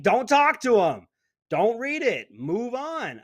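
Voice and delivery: loud, deliberate lecturing; emphatic. Real, not acted. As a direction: hammer the advice home. Ranting advice on ignoring people.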